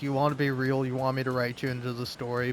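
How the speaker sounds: normal talking voice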